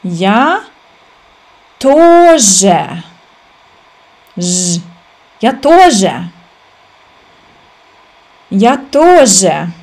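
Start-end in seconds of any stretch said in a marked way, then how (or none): none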